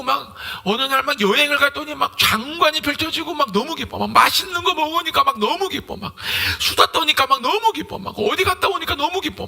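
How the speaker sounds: high, bubbling list of delights; mock gushing. Believer thrilled by trips and food, mock gushing. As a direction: gush over each treat, hammed-up. Acts delight at worldly pleasures.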